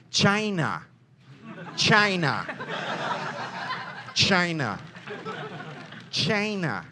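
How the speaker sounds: lightly done Trump, each China drawn out and dropping; Trump impression. Tour guide riffing to his group, light Trump impression. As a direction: mimic Trump hammering the word China, a light caricature. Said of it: "that's my Trump impression".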